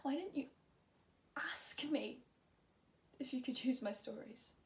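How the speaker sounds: soft, halting question after the outburst; quiet, subdued. Acted. Patient asks Vivi about using the stories, quiet and subdued.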